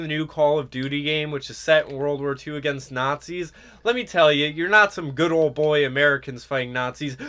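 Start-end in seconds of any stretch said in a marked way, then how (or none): none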